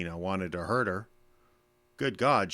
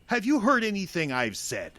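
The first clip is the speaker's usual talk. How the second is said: exasperated; 11 semitones higher, 4 dB louder than usual. Acted. Father exasperated at daughter ignoring him.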